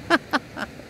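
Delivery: quiet high voiceless chuckle; amused voiceless giggle; soft laugh under the breath. Real, not acted. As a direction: let out a small voiceless laugh. Laughs off the bank story.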